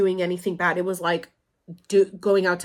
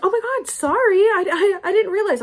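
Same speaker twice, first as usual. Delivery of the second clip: high, flustered imitation of mom, louder; mock flustered mom; 13 semitones higher, 6 dB louder than usual. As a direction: squeak out a startled apology as mom. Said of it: "And she's like".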